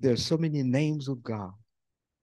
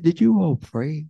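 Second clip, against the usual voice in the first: louder, raised challenge; pointed question; 4 semitones higher, 9 dB louder than usual. Retold or imitated. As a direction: press them hard on prayer. Pastor confronting the couple.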